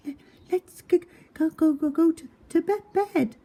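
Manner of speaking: freezing wife chattering her way to bed; teeth chattering. Acted. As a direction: shiver through every broken word. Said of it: "Jan chattered".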